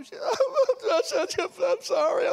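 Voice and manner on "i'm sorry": very high mock wail; imitated bawling. Daughter apologizing to daddy, wailing in tears. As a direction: blubber an apology like a bawling kid. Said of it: "And she's crying".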